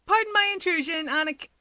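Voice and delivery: polite entrance call into the apartment. Acted. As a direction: call it politely and briskly from the door. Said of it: "a polite call of".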